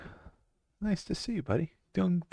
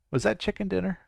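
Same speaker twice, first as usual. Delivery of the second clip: ordinary talk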